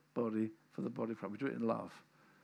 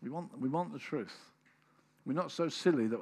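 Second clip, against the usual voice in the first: usual speaking voice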